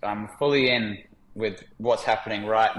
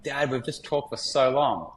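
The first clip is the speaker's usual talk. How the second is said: a lightly voiced small boy, slow and a bit higher; imitating his young son; 4 semitones higher than usual. Father quoting his six-year-old son on the beach, lightly voiced child. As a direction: gently mimic a six-year-old marvelling. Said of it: "he's talking like this".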